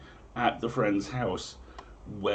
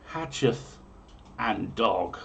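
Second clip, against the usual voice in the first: grand, raised olde-English storyteller read; dramatic prophecy reading; 6 semitones higher, 3 dB louder than usual. Acted. Host reading medieval omens to listeners, grand storyteller voice. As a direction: proclaim each omen like a town crier.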